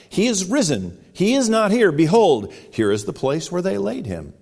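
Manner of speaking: high, loud proclamation of the angel; proclaiming angel. Acted. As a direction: ring it out like a herald. Preacher reads angel's proclamation.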